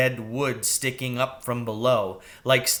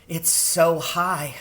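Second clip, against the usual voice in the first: high, slow, timid mouse; a frightened little mouse looking down; small mouse voice, slow; 5 semitones higher, slower than usual. Acted.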